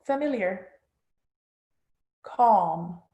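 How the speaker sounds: soft spa whisper. Acted. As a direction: soothe them into stillness, unhurried. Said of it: "let's move into the guided meditation".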